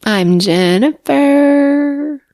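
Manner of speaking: high, slow tipsy voice. Real, not acted. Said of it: "I gotta go put her to bed, people".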